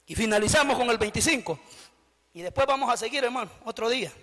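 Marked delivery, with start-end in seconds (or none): none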